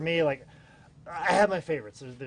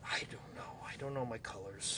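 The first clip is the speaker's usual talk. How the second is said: sheepish whisper, much softer; 6 semitones lower, 14 dB quieter than usual. Real, not acted. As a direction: drop to a breathy whisper. Embarrassed about not knowing colours.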